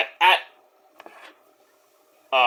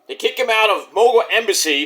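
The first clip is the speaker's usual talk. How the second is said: ordinary delivery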